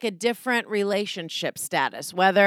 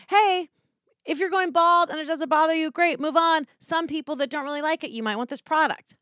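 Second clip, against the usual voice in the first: higher, easygoing mock ad voice; gentle ad read; 7 semitones higher than usual. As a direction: lightly play a laid-back, no-pressure advert. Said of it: "The ad reads are not".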